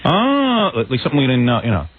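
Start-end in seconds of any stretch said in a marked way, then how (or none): 0.1-0.7 Rosie O'Donnell. Retold or imitated. Imitated host feigning amazement at the news, high mock gush. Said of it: "she says".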